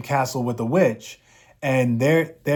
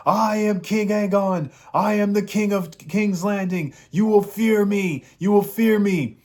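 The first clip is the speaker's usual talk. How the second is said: hammed-up king proclaiming dominance; mock threatening king; 8 semitones higher than usual. Aegon warning the army as his dragon growls, high hammed-up threat.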